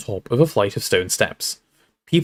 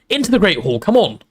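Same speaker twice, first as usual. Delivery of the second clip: higher, brisk stern order; Professor McGonagall, sharp; 7 semitones higher than usual. A narrator's reading. Professor hurrying students into the hall, sharply. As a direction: usher them in impatiently. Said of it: "said Professor McGonagall sharply".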